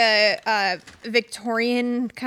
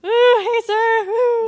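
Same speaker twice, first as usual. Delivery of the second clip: excited whoop; 10 semitones higher, 10 dB louder, slower than usual. Pretend. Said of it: "I'm gonna be like".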